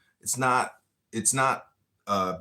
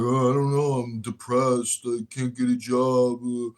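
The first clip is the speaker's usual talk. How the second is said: higher, louder mock whine of the depressed youth; gloomy young people; 3 semitones higher, 4 dB louder than usual. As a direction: whine out their complaints, lightly hammed. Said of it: "And they're like".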